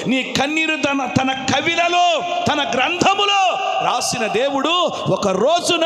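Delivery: impassioned, rhythmic sermon cry; full-force preaching. Real, not acted. Preacher proclaiming God who sees tears, impassioned. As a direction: roll out each phrase and swell to a shout.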